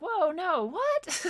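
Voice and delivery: high startled whoa, voice jumping way up; shocked recoil. Retold or imitated. Hearing a sneaky word slip into her prayer, high startled whoa. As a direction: recoil in high-pitched alarm. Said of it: "You're like".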